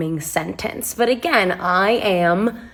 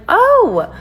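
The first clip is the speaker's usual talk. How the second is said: high, loud startled oh; shocked oh; 14 semitones higher, 6 dB louder than usual. Retold or imitated. Finding out the stranger's job, loud shocked oh. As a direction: jump up in sudden shock. Learning he's an adult film star.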